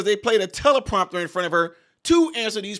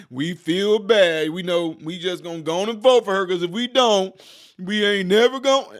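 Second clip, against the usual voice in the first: mock guilty voter; 2 semitones higher, 6 dB louder than usual. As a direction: play the cowed voter who caves, laying it on. Mocking voters pressured to vote.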